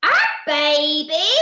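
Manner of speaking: loud, drawn-out rising question; astonished yell. Acted. Percy seeing inside the chest, astonished yell. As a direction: yell it slowly in disbelief. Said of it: "yelled Poop Deck Percy Plop".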